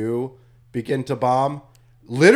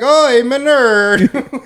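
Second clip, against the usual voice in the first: mock nerd voice; 9 semitones higher, 9 dB louder, much slower than usual. Mocking actor's nerd line.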